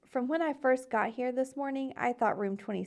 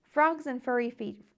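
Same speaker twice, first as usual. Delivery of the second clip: ordinary delivery, nothing marked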